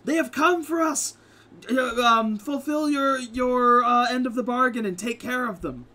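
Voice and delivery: high, loud desperate cry to the heavens; calling out to a god. Acted. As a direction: shout it up to the sky, pleading. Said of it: "he called out".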